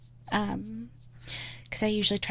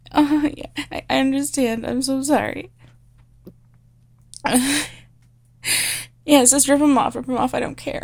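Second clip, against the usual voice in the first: higher, pleading, breathless submissive voice; pleading girlish secretary; 7 semitones higher, 4 dB louder than usual. Acted. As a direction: beg him not to fire you.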